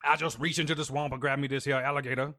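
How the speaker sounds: loud Cajun swamp man; playful, loud Cajun voice; Cajun accent. Acted. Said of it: "I'm trying to do a Cajun voice".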